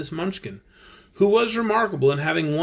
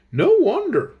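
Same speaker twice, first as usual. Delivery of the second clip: Scarecrow remark; 11 semitones higher, 5 dB louder than usual. Acted. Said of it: "remarked the Scarecrow".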